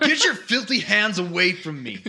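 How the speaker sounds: loud and disgusted, laughing; disgusted shout. Acted. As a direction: spit it out in revulsion. Archer resists being fed.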